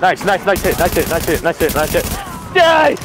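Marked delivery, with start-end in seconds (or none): 2.5-3.0 higher battle shout; battle yell. Real, not acted. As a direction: yell it at the enemy. Shooting enemies.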